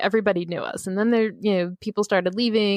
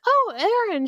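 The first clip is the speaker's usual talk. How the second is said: surprised and delighted to see a regular; excited greeting; 13 semitones higher than usual. Retold or imitated. Barista spots Erin at the drive-thru, high excited greeting. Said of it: "And she's like".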